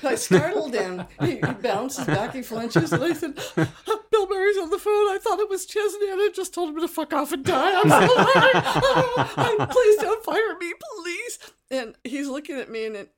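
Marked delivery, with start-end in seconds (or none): 3.3-11.4 loud, very high comic meltdown; hysterical pleading. Retold or imitated. Receptionist confessing to her boss, hysterical begging. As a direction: wail and beg, hammed-up panic.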